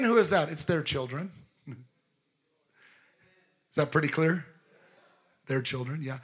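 usual speaking voice

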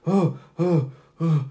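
loud gasping breaths; heaving acted breaths, pushed out hard; heavy breathing. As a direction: gasp for air after coming round. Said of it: "breathing heavy".

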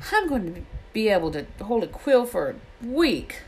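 high-pitched, aggrieved rant; indignant complaint. A narrator's reading. Ron grumbles about the dragon that bit him, indignant. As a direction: complain with swooping high emphasis.

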